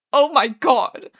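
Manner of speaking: slow, loud anguished moan. A narrator's reading. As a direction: draw it out as a despairing moan. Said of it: "Arthur moans".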